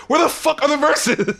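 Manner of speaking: high-pitched exasperated demand, half joking; mock infuriated. Listener fed up waiting for verses, mock infuriated. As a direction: act out comic frustration at the song. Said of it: "it infuriates you after a while".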